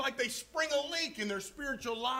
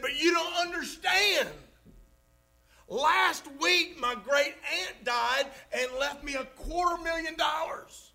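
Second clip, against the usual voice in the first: higher and louder, hammed-up insistence; mock-frantic protest; 5 semitones higher, 3 dB louder than usual. Sad man insists to his friend about more money, hammed-up and loud. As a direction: push it up like he can't believe you're missing it.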